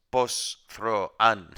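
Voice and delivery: careful syllable-by-syllable demo; syllables read backwards. Shown as a demonstration. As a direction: sound out anthropos backwards, one syllable at a time. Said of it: "la palabra anthropos, aunque está al revés".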